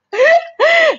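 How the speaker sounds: real high-pitched laugh; short burst of amused laughter. Real, not acted. Thanking the mentor for those moments, amused laugh.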